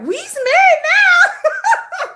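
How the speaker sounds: very high, slow, laughing sing-song; Color Purple quote. Retold or imitated. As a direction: stretch it out, cracking up as you quote the movie. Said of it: "'Cause we like The Color Purple".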